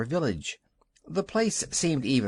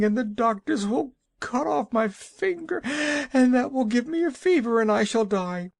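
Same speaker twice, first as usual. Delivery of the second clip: crying fretful wife; 11 semitones higher than usual. A narrator's reading. Weeping wife tells Dorothy her fears. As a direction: whimper out the whole disaster.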